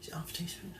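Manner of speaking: a doubtful aside, breathed not voiced; whispered to herself. Real, not acted. Cook double-checks the recipe quietly, whispering. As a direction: whisper the question to yourself, unsure.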